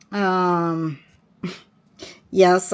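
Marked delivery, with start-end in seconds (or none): none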